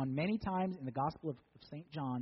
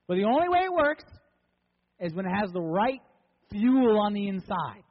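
loud, raised preaching voice with hard emphasis; impassioned preaching; 5 semitones higher, 11 dB louder than usual. Acted. Preacher declaring how life was designed, impassioned preaching. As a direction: drive each phrase home to the congregation.

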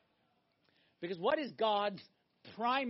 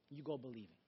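softer, lower repeat; 10 semitones lower, 13 dB quieter than usual. Real, not acted. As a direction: echo the line much softer and lower. Repeated line, second said softer.

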